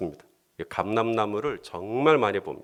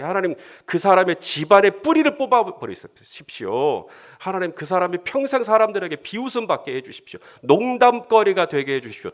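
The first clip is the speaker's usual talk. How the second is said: higher, urgent plea; honest angry prayer; 6 semitones higher than usual. Pretend. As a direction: demand judgment from God. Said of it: "아주 정직하게". (정직하게 분노하는 기도)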